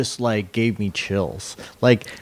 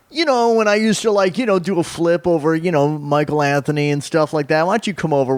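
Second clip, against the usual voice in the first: high, animated singer impression; 4 semitones higher than usual. Friend imitating the singer explaining his stage flips, high animated voice. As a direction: start high and ease down as you pitch the plan. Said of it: "He's like".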